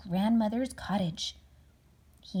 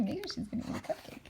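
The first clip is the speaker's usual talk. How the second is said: laughing whisper; 8 semitones higher, 7 dB quieter, faster than usual. Real, not acted. Parent chats to child.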